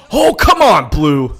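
exasperated protest. Real, not acted. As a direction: yell in protest at the umpire. Protests umpire's call in game.

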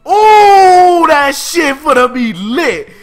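high, loud, stretched-out hype; hyped shout. Real, not acted. Fan hyped for the Natsu–Zeref rematch, loud slow shout. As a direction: scream it like the fight is here.

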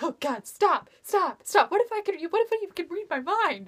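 a fan scolding her own thoughts; panicked inner voice. Retold or imitated. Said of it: "I'm like".

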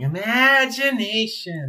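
high, slow, dreamy cartoon voice; drawn-out wondrous cartoon sponge; SpongeBob impression. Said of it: "SpongeBob meme where he's going".

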